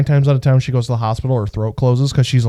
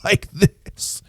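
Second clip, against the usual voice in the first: slow, broken air-leak voice; imitated failing voice, word by word; talking through a trach; 6 semitones higher, slower than usual. Shown as a demonstration. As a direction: demonstrate speech with air escaping the throat. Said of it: "So all of a sudden".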